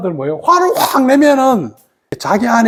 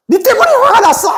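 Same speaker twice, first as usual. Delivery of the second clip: acted angry, high-pitched blaming cry; angry outburst; 11 semitones higher, 3 dB louder, faster than usual. Someone blaming another for their temper, angry outburst. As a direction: lash out in mock anger, pitched way up. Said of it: "화를 확 내면서".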